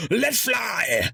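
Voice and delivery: high, drawn-out command call; epic battle call. Acted. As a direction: call it out across the deck. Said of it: "an epic call of".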